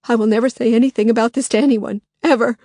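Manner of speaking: fast, loud, high swearing; Lily swearing on her children's lives, frantic; desperate oath. Acted. Frantic vow.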